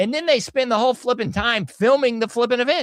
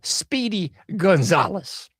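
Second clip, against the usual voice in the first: slow emphasis; 3 semitones lower than usual. Real, not acted. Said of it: "look up".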